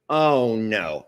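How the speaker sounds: ordinary talk, nothing marked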